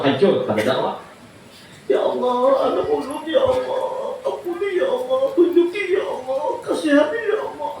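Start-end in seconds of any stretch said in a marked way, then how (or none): 1.9-7.7 desperate plea. Acted. Parent begs God for child.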